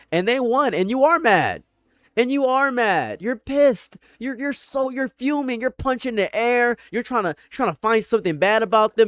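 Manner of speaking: high, teasing mock pity. Describing the fuming victim, high teasing mock pity. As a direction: rub it in with a high mocking tone.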